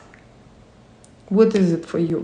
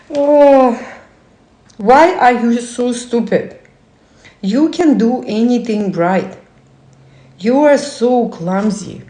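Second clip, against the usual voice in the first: harsh inner critic; 2 semitones higher than usual. Acted. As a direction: berate yourself like a nagging critic. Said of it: "inner voice, not cheerleader, but opposite".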